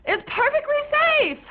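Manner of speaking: high, pleading insistence; insistent whine. Acted. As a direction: push the words up high to convince. Repeated insistence.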